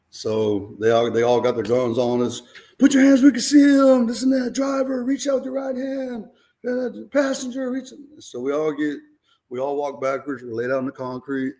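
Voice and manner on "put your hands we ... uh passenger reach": high barked police orders; loud, high cop voice yelling commands. Retold or imitated. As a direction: bark orders like a cop at gunpoint. Cops shouting orders at gunpoint.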